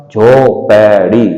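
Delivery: झोपड़ी drawn out slowly; धीरे झोपड़ी; slow sounding out. Shown as a demonstration. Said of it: "धीरे धीरे बोलिए".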